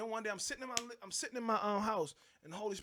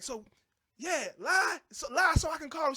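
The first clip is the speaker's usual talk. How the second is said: higher, louder challenging shout; mock angry wife; 8 semitones higher, 8 dB louder than usual. Wife daring husband to lie, mock angry shout. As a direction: dare him to lie.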